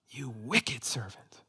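slow, soft, whispered condemnation; hushed rebuke. Acted. Preacher voicing what Jesus would say to us, hushed rebuke. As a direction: let the rebuke sink to a whisper. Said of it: "That's what Jesus would say to us".

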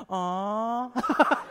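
high, drawn-out mock sympathetic aww; onlookers' aww. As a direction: imitate a pitying crowd behind you. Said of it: "I hear the people in the back".

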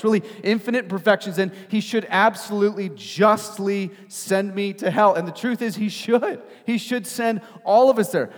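loud, high-pitched sermon delivery; impassioned preaching. Acted. Preacher telling congregation God comes after them, impassioned and loud. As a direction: preach it out with force, punching key words.

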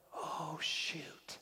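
guilty whispered aside. Retold or imitated. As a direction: hush it like a guilty slip. Guilty inner thought quoted.

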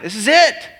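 high, exclaimed discovery; acted-out moment of revelation; excited realization. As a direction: burst out as if it just clicked. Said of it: "And I'm like".

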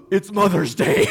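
sharp high gasp, then a loud "It's Mother's Day"; shocked gasp. Retold or imitated. A kid bursting in, realising it's Mother's Day, gasping. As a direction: gasp like you just remembered. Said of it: "a kid came in earlier and said".

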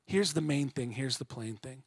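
ordinary talk, nothing marked

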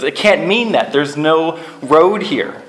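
high, loud, slow protest; yelling. Retold or imitated. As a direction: yell it, drawn out and alarmed. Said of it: "Stop yelling at me".

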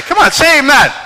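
rally the crowd; high, loud call to the congregation; preacher call. Real, not acted. Calling congregation again.

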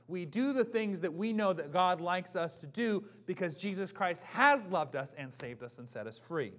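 loud, slow preaching voice; full-force sermon delivery. Acted. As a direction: proclaim it to the congregation.

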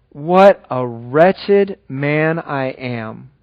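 very slow, drawn-out confession; slow lament. Acted. Preacher voicing Paul's confession, slow lament. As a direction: weigh out each word of the lament.

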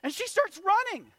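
high, loud exclamation; loud, high punchline shout; shouted storytelling. Retold or imitated. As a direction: keep yelling, as comic narration. Still shouting after the yelled name.

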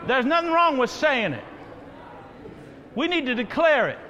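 ordinary talk, nothing marked

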